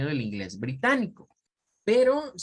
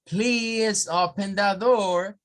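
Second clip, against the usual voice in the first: slow, higher American accent with a clear R; American accent request; 6 semitones higher, 4 dB louder, much slower than usual. Shown as a demonstration. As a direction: ask politely in American English. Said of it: "eso es inglés norteamericano".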